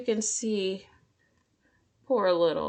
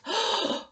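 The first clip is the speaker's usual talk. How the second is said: gasp; 12 semitones higher than usual. Acted. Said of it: "the crowd gasped".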